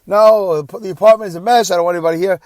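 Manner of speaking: loud, high, agitated protest; stubborn refusal. Retold or imitated. Father keeping visitors out of his messy apartment, stubborn. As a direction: imitate a man defensively shutting the offer down.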